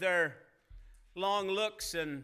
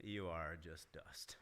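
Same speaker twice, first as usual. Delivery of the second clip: gentle God voice; 11 semitones lower, 13 dB quieter than usual. Retold or imitated. God gently reminding Calvin, tender and hushed. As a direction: murmur it like a loving father to his child. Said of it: "he says, like".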